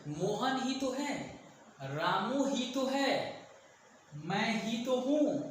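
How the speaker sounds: slow, leaning hard on ही तो in each line; drawn-out emphatic ही तो है with a falling close; emphatic stress. Shown as a demonstration. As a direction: press ही तो है firmly and slowly. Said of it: "sentence को stress दे रहे हैं".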